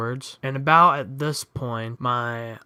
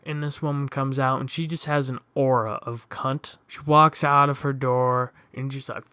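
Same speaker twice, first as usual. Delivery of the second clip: ordinary talk, nothing marked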